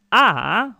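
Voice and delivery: "a," said on its own, loud and open; vowel sound demo. Shown as a demonstration. Teacher to students, sounding out the ending loudly. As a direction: call out the single vowel "a," loud and clear. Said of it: "If it's finishing".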